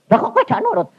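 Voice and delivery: high-pitched indignant demand; offended prophet. Retold or imitated. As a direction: mimic an exasperated "why won't you obey". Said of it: "Marah, nggak dipercaya". (menirukan nabi tersinggung, nada melengking)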